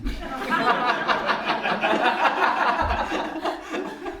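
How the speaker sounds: drawn-out, rising laugh; long laugh. Real, not acted. Devotee laughing at his own dry eyes before the deity, a long real laugh. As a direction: let a long laugh roll out.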